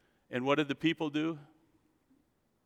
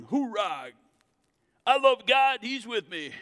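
cheering; 8 semitones higher, 4 dB louder than usual. Pretend. Imagined bold believers cheering.

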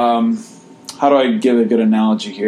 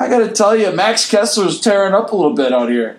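put-on Boston accent; 8 semitones higher than usual. Boston accent bit continues.

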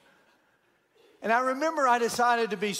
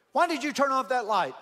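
high, loud Aussie demand right in his face; mock Australian in-your-face challenge; angry Australian; 6 semitones higher, 4 dB louder than usual. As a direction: confront him like a furious Aussie. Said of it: "That sounded like an Australian accent".

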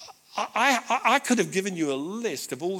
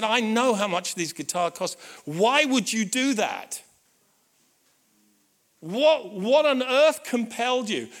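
higher, louder pleading; broken, bewildered son; 6 semitones higher, 5 dB louder, slower than usual. Retold or imitated. Son asking dad why he spent so much, bewildered pleading. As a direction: plead it in overwhelmed disbelief. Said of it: "in his brokenness".

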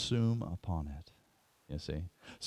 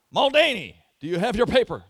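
loud, higher, fast roll call; calling out names; 12 semitones higher, 14 dB louder, faster than usual. Retold or imitated. Professor calling a student by name, loud and fast. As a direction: call the name across the hall.

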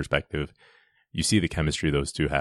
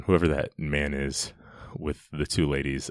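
ordinary talk, nothing marked

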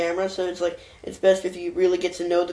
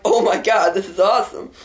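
louder, thrilled exclamation; excited; 6 dB louder than usual. Retold or imitated. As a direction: gush with delight. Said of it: "I'm like".

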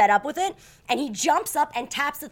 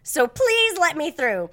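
louder, pleading and slowing down; stuck at a parking gate, begging the attendant, raised voice; freaking out; 6 dB louder, slower than usual. Retold or imitated. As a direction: demand to be let through, getting desperate. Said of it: "I start freaking out".

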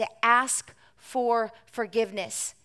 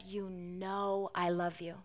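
quiet reply; 4 semitones lower, 12 dB quieter, slower than usual. A narrator's reading. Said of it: "Peter answers softly".

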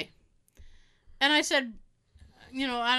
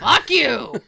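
loud abusive heckle; sudden mock-hostile yell; heckling yell; 9 dB louder than usual. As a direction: shout it at him across the hall. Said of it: "just yell".

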